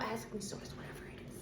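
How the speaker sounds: whispered aside, breath only. Real, not acted. Tarot reader to viewers, sudden whisper.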